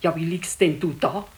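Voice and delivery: ordinary talk, nothing marked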